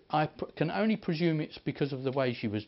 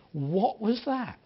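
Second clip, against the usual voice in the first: high, slow, wondering ask; a retold woman's astonished what-was-that; amazed question; 6 semitones higher, 3 dB louder, slower than usual. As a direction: let the wonder stretch the words out. Healed woman asks in amazement.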